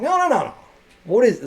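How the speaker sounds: high, loud "no, no, no, no"; mock stern teacher. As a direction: cut the kid off and demand to see it. Teacher confronting drug deal.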